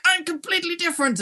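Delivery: high-pitched proclamation; exaggerated teenage announcement; mock newly-adult boast. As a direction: declare it high, mocking the newly grown-up. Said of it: "It's like when people turn".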